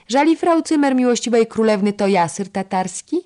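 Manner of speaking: high, loud, cheeky comeback; insolent retort. A narrator's reading. Defiant young lady-in-waiting answering the scolding, insolent. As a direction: talk back boldly to a superior. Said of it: "zuchwale odburknęła Baśka".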